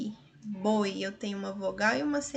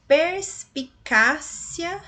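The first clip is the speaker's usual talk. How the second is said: Perspicácia spoken slowly, syllable by syllable; teacher sounding out Perspicácia; slow syllable demo; 5 semitones higher, 5 dB louder, much slower than usual. Shown as a demonstration. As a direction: break Perspicácia into slow beats. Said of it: "nós temos um ditongo no finalzinho".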